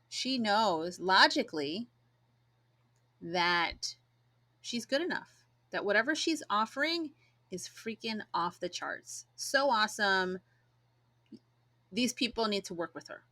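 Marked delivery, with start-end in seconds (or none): none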